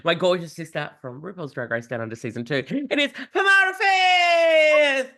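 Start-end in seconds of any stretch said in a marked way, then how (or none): 2.6-5.1 grand announcement. Acted. Host announces guest's grand entrance.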